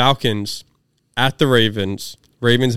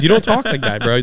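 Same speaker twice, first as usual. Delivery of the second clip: normal talking voice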